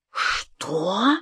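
soft, drawn-out rising question; stunned. A narrator's reading. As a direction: ask it dumbstruck, under the breath. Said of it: "обомлела я". (обомлев, тихо)

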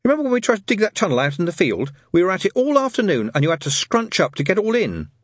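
put-on high, emphatic child's voice; Pepper, objecting child. Child reminds friends of their own digging, emphatically. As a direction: argue from experience, pitched well up.

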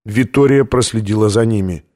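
ordinary delivery, nothing marked

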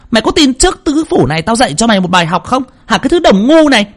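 possessed woman shrieking fury; shrieking rage. Acted. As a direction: scream abuse at the medium. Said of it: "rít lên".

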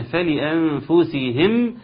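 فلأنفسهم slowly with the sakin mim clearly sounded. Shown as a demonstration. Teacher isolating the sakin mim. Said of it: "اظهرها".

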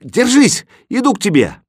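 high, loud shout of encouragement; urgent call. A narrator's reading. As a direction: shout that help is coming. Boy calls to stranded cat.

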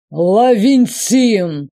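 slow, loud booming name; booming call. Acted. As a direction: roar the name out slowly. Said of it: "пророкотал".

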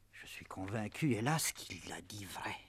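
whispered despair. Acted. Father crushed by news.

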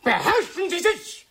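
very high, strained yell; shrieked, indignant command; outraged rebuke. Acted. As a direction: cry out a furious call to order. Shouting match, orders self-control.

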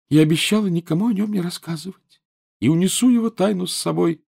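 high, reproachful schoolgirl ghost; whiny ghost girl. A narrator's reading. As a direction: sulk like a neglected ghost girl. Narrator voices Myrtle.

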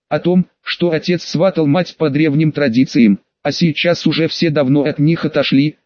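ordinary delivery, nothing marked

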